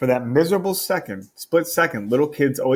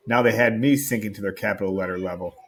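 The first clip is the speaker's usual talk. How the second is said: plain reading voice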